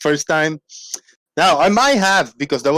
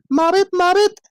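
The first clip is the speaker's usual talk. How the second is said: high squeaky mama frog mobbit; 12 semitones higher than usual. Acted. Streamer voicing mama frog for chat, high squeak. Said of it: "Mama Frog goes".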